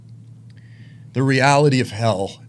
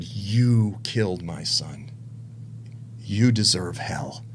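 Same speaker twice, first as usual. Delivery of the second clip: slow, low, hushed verdict; God's quiet accusation; 6 semitones lower, 6 dB quieter, slower than usual. Retold or imitated. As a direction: pronounce judgment softly and slowly. Voicing the Lord's accusation.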